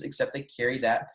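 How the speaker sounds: normal talking voice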